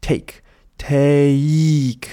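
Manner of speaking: usual speaking voice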